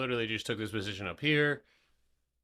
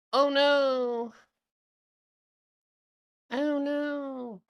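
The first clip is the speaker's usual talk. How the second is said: high mock panic; 8 dB louder, much slower than usual. Mock alarm at chart.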